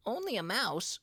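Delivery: high, offended squeak; an indignant little mouse cry. A narrator's reading. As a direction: bristle at the insult in a tiny high voice. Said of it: "cried the little animal indignantly".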